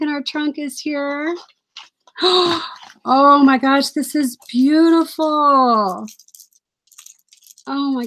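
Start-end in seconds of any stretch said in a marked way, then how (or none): 2.2-2.7 a sharp, delighted gasp. Real, not acted. Sharp delighted gasp on opening a friend's gift. 3.0-6.0 delighted surprise. Real, not acted. Gushing to viewers over a gift from a friend.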